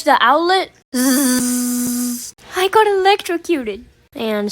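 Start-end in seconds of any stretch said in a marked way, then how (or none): 0.9-2.3 drawn-out buzzing zap; imitated electric zap. Imitates the outlet's electric shock. 2.6-3.8 high-pitched, dramatic outcry; dramatic exclamation. Retold or imitated. Dramatic punchline of shock story.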